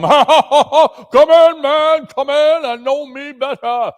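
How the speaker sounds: high, loud booming laughter; jolly giant ghost bellowing a welcome. Acted. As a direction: laugh him into the room. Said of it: "bellowed out at him".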